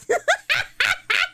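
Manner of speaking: loud, high burst of laughter; high cackling laughter; excited laugh. Real, not acted. Laughs about being excited.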